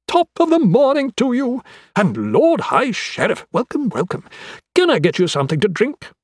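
high-pitched, effusive host; eccentric, cheery anatomist. Acted. Anatomist welcoming Queen and High Sheriff, effusively cheery. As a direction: welcome them with bubbly, sing-song eagerness.

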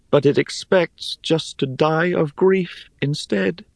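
high-pitched character voice with a sad report; high, earnest storybook girl; Rhea, sorrowful. A narrator's reading. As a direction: relay the old tree's grief in a high voice. Voices Rhea relaying the tree's sorrow.

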